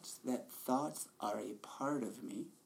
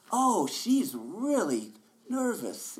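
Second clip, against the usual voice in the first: higher, louder, slow commentary on her nerves; narrating inner voice; 11 semitones higher, 10 dB louder, slower than usual. Retold or imitated. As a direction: gossip about yourself like a dramatic narrator. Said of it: "incessant voice in your head narrating".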